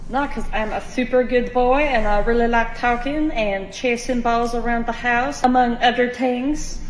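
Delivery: 낮고 조용한 강아지 Kevin 목소리; 착한 강아지인 척 살짝 낮게 중얼. Acted. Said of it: "This is just Kevin's voice".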